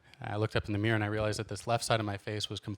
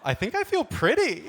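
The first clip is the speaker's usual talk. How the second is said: high, loud and giddy, half laughing; 13 semitones higher, 7 dB louder than usual. Pretend. Admiring himself in the dressing room, giddy and high. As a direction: preen like you feel pretty. Comic sings to himself in mirror.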